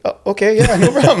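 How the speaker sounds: high, laughing yes; flustered, giggling go-ahead; laughing agreement. Retold or imitated. Coach agreeing to the photo, laughing and flustered.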